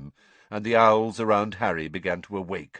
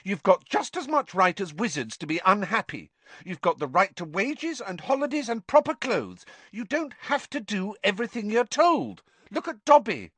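higher, exasperated outburst; angry Hermione; 11 semitones higher, 4 dB louder than usual. Acted. Hermione angrily scolding the house elves. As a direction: snap it out in frustration. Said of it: "said Hermione angrily".

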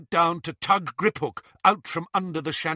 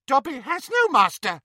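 high, louder squeaky elf; squealed; 10 semitones higher, 5 dB louder than usual. A narrator's reading. Dobby answering Bellatrix, squeaky elf. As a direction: squeal it proudly. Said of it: "squealed the elf".

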